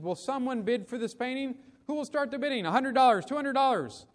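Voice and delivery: high-pitched auctioneer calling for bids; persistent auctioneer. A narrator's reading. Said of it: "But the auctioneer persisted".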